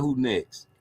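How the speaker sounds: ordinary talk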